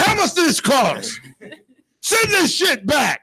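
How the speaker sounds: high, slow hammed-up wail; mock outrage. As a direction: play the panicked husband over the bill. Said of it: "I was like".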